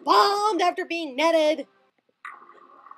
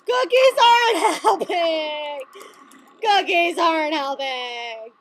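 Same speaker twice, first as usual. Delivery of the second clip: slow, drawn-out wail of defeat; whiny, hammed-up misery; despairing wail; slower than usual. Real, not acted. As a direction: moan it miserably with a laughing sob. Loses despite comfort cookie.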